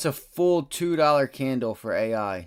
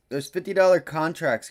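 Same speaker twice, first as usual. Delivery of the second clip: ordinary delivery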